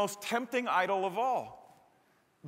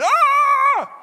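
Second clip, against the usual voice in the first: much higher, louder sharp yelp; sudden yelp; 13 dB louder than usual. Acted. Pastor flicks a toy quarterback, sudden high yelp. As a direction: yelp as the toy flings the ball.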